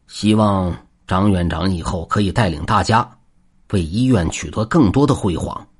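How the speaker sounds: usual speaking voice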